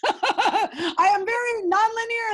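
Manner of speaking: high, slow words through big laughter; laughing. Real, not acted. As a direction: laugh helplessly while confessing it. Laughing at her own confession.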